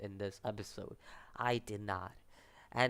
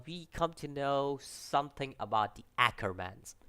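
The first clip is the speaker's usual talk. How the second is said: usual speaking voice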